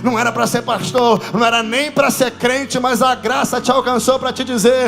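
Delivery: building from a steady telling to a loud, high, sung-out proclamation; preaching at full force. Acted. Pastor rousing the congregation about grace, building to a full shouted sermon.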